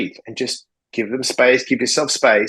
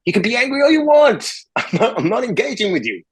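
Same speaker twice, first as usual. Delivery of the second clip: raised, much higher-pitched voice; retold heated dismissal; 9 semitones higher than usual. Telling the shopper to leave over mac and cheese, retold heated dismissal. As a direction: send him off emphatically, pitch jumping up.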